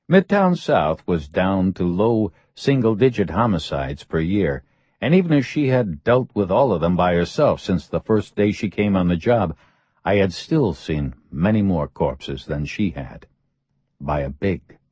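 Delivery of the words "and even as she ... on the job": ordinary delivery, nothing marked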